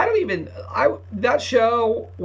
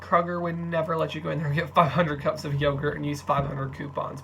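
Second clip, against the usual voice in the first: usual speaking voice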